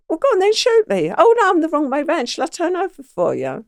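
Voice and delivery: high, quick goading in a retold quote; high-pitched, hurried mock dare. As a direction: re-enact egging them on, high and fast. Acts out defiance at gunpoint.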